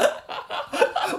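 breathless high-pitched giggle; helpless airy giggling. Real, not acted. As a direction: burst into a squeaky laugh. Cracking up at a joke.

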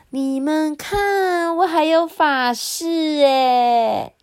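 high, slow singsong show-off; pleased cartoon gadget, drawn out; happy talking rice cooker. A narrator's reading. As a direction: show it off proudly in a high, stretched creature voice. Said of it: "电子锅就高兴的转".

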